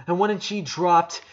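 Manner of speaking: usual speaking voice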